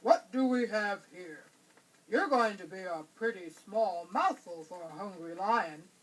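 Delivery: a hint of a hungry lion toying with prey. A narrator's reading. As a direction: savor the catch like a big cat.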